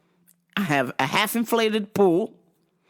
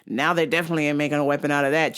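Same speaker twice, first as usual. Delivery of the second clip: usual speaking voice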